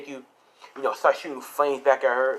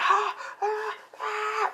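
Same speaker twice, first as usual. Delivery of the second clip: repeated very high, thin-volume screams; acted wailing screams of pain, held back in volume; agonized screaming; 6 dB quieter, much slower than usual. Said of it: "So she's screaming".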